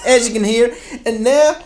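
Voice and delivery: comic David Beckham phone call voice; David Beckham impression. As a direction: do a breathy-free high London footballer voice. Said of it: "It's a fantastic accent".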